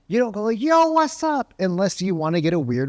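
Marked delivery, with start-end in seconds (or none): 0.6-1.5 high, over-excited greeting; mock hyped-up phone hello; super fired up. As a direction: hail a friend with far too much hype. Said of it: "you're super fired up".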